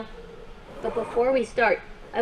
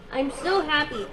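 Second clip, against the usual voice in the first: normal talking voice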